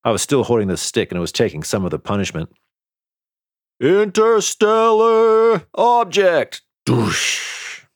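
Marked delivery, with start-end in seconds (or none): 3.8-7.9 high, loud, drawn-out battle cry; yell. A narrator's reading. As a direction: stretch out the yell slowly, then add a crash noise. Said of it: "yelled Risto".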